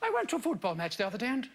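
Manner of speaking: put-on quick, lightly posh English accent; a hint of a plummy English accent. Mocking a radio vicar, light posh English accent. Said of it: "Pretty good English accent".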